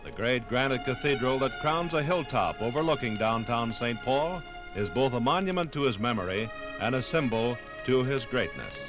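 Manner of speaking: ordinary talk, nothing marked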